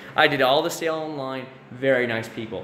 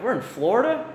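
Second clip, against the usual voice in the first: 1 mock surprise; 7 semitones higher than usual. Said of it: "everybody's like".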